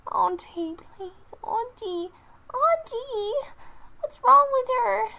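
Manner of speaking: put-on high, rising, loud child's cry; a scared kitten calling its aunt; frightened kitten voice. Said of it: "Salmon Kit looked at Daisy Rain, bewildered".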